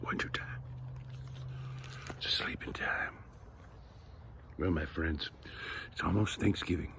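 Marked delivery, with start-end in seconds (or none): 0.0-3.1 very soft, whispered sign-off; sleepy whisper. A narrator's reading. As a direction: whisper so the sleepers stay asleep. Hushed close of winter story.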